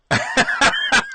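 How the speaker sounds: very high, squealing laugh; high squeal of laughter. Real, not acted. Laughing at his love of Mannix, high squeal. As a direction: burst into a high-pitched giggle.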